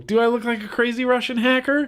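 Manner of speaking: high, rising question in own accent; incredulous question. Real, not acted. Vlogger questioning a viewer's lookalike comment, incredulous. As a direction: throw it back as a high-pitched question.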